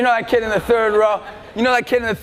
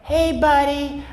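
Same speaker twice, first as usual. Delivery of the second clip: put-on nice old lady; much slower than usual. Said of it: "this nice lady comes down".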